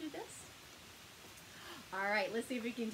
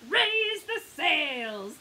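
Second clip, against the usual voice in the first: loud, slow, stretched pirate order; commanding; 4 semitones higher, 8 dB louder, much slower than usual. A narrator's reading. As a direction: command it like a little pirate crew. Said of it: "command her little pirates".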